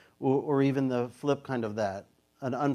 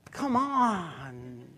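gentle laughing tone; 5 semitones higher than usual. Shown as a demonstration. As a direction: coax with a laugh. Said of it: "It's just tone".

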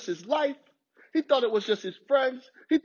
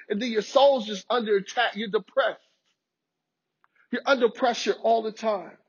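ordinary talk, nothing marked

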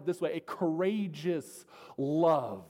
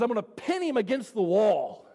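angry threat; 4 semitones higher, 5 dB louder than usual. Retold or imitated. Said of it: "He says".

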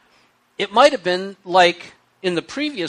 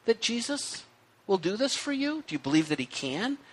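soft, gentle, higher questions; gentle asking; 3 semitones higher, 10 dB quieter than usual. Retold or imitated. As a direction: ask it softly and kindly. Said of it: "I think it's okay to ask".

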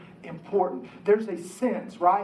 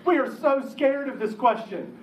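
higher and louder, laughing through the words; 8 semitones higher, 4 dB louder than usual. Real, not acted. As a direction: laugh at your own confession. Preacher laughing at men's fear.

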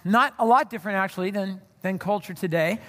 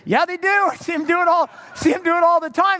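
high, louder, faster insistence; insistent teen; 7 semitones higher, 5 dB louder than usual. Retold or imitated. Teen insisting to the youth worker that Christians do it, loud high insistence. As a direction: argue it like an eager know-it-all kid. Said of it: "I said".

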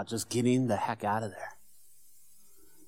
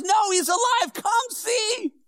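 excited shout; 8 dB louder, slower than usual. Retold or imitated. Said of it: "Andrew says".

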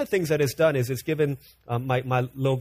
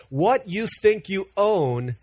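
quoted saying; 3 dB louder, slower than usual. Retold or imitated. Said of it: "Here's how Rick Warren says".

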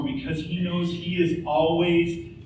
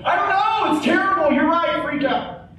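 mock panicky friend; 11 semitones higher, 4 dB louder than usual. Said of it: "what we hope is that they kind of say".

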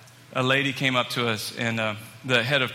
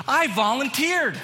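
high, drawn-out mock-proud declaration; proud announcement; 10 semitones higher, slower than usual. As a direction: imitate an eager newcomer revealing big news. Mike proudly reveals his news.

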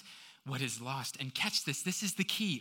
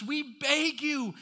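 high, drawn-out pleading scripture reading; impassioned preacher's plea; urgent appeal; 8 semitones higher, 3 dB louder than usual. Acted. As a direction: implore the congregation earnestly. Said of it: "Are you catching the urgency there from Paul?".

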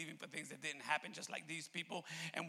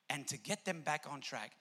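ordinary talk, nothing marked

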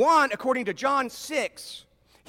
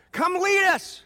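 high, loud plea to a leader; rallying cry; 8 semitones higher, 5 dB louder, slower than usual. Acted. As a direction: call out to your champion. People calling for a warrior Messiah.

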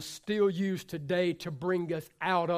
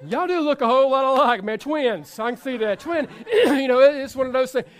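louder, laughing through the words; laughing joke; 4 semitones higher, 9 dB louder than usual. Real, not acted. Preacher joking with congregation, laughing.

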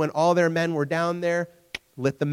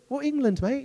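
high, put-on English accent; imitation English bravado, pitched up; mock English accent; 10 semitones higher than usual. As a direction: play a cocky English soldier with an English accent. Repeats line as cocky Englishman.

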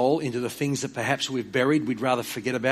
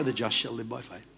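low, quiet steady reply; God repeating patiently, quieter; soft firm divine answer; calm God's answer; 4 semitones lower, 8 dB quieter than usual. Retold or imitated. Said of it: "he speaks very clearly".

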